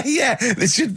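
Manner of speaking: said through a laugh; chuckling, amused. Real, not acted. Joking about a house with holes, laughing.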